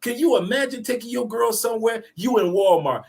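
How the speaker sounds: ordinary delivery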